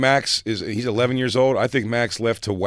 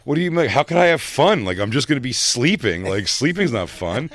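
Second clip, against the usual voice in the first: high, louder mock-defensive teen; over-explain it in a higher, whiny teen voice; defensive over-explaining Max; 6 semitones higher, 4 dB louder than usual. Mimicking the boy protesting he's just going to sleep. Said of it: "he starts doing, like, the".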